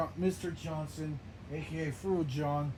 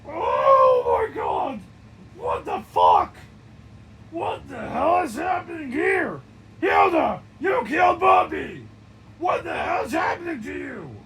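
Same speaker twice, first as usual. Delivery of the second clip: loud, high horrified yells; shocked shouting; 10 semitones higher, 13 dB louder than usual. Acted. Characters react to Hilda killing Bobby, shocked shouting. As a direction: scream it like you just saw a murder.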